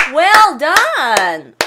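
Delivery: loud cheer of "Well done". Pretend. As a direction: cheer like a fan at a basket. Cheering a basket.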